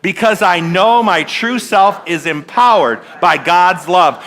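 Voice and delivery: slow, firm call and response; leading a group affirmation. Shown as a demonstration. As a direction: lead them in saying it together. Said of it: "Let's say that together".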